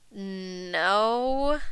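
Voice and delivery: small, soft, drawn-out no; sheepish rising no; guilty child. A narrator's reading. Guilty child answers mother.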